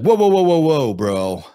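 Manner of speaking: loud, high startled whoas; alarmed whoa. Pretend. Streamer pretends a stranger approaches him in a lot, loud alarmed whoas.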